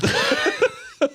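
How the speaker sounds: a high, real burst of laughter. Real, not acted. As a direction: laugh out loud at the punchline. Laughing at own story.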